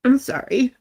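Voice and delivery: choked-up little apology; real tearful apology. Real, not acted. Streamer breaking off before viewers to cry, tearful. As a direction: apologise while fighting back tears. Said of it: "yell at me for blubbering".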